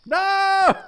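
high, loud shriek of no; a squealing no through laughter. Real, not acted. Streamer laughing at wiener pictures, shrieking no.

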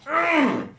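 loud strained mm; high tense hum. Real, not acted. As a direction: hum it through the tension. Gamer strains.

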